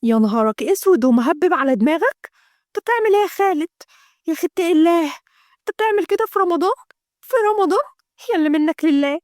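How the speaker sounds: high, fast shocked outburst; a sister scandalised at her brother. Acted. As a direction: gasp it out in disbelief. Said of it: "مروة بصدمة".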